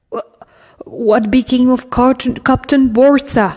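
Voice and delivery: a shaken priest asking after the captain; confused. Acted. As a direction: stumble over the words, confused and alarmed. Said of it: "all confused".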